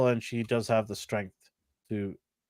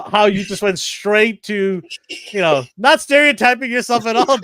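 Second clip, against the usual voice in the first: teasing call-out; 8 semitones higher, 7 dB louder than usual. Real, not acted. Host ribbing co-host over his pick, teasing call-out. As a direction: rib a friend loudly, drawing it out.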